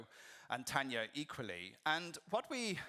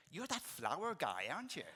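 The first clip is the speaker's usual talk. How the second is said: imitating the nurse; 5 semitones higher than usual. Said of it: "he came back and he went".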